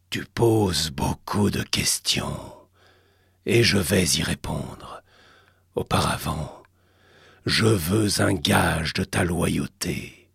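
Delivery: low, slow otherworldly rumble; cavernous entity voice. Acted. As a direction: speak like an ancient god. Alastor speaks.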